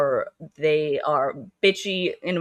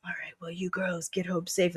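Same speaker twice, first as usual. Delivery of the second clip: whispered mock heartthrob; broody love interest; 7 dB quieter than usual. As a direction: murmur it like a brooding hero. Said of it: "like a broody l-, main character love interest".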